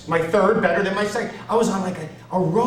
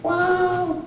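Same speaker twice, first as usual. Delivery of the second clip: high amazed wow; awed wow; 3 dB louder than usual. Real, not acted. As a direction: gasp it out in awe. Awe at Carson.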